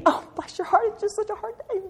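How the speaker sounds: high, sugary fake sympathy; sing-song pity that coddles a friend through her hard day; mock syrupy pity. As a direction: coo it like a pitying church lady. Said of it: "when we allow each other to be".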